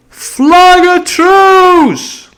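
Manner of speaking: long, drawn-out high call; cried out. Acted. Silver calling out to the stockade, drawn-out cry. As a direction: stretch the call far across the clearing. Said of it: "cried Silver".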